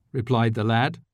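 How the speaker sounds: usual speaking voice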